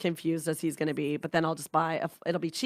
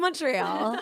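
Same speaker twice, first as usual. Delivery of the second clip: ordinary talk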